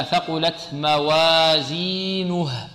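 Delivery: slow, drawn-out recitation of موازينه; slow tajweed recitation; Quran recitation demo. Shown as a demonstration. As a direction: recite موازينه slowly with no imala. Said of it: "هكذا".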